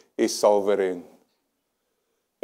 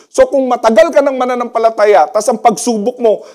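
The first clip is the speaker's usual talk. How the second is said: normal talking voice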